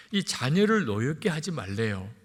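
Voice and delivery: ordinary talk, nothing marked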